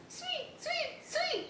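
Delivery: very high, soft tweeting sweet, sweet; high, soft sparrow song; soft birdcall. A narrator's reading. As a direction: peep it like a tiny sparrow.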